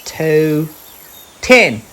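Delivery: high, loud little wombat voice; a small child skipping numbers; Little Wombat counting. Acted. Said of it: "he said".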